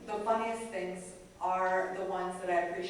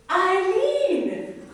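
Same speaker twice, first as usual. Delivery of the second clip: much higher, louder pun through a laugh; husband's punchline; 12 semitones higher, 11 dB louder than usual. Retold or imitated. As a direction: deliver the name as a laughing punchline. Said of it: "And he goes".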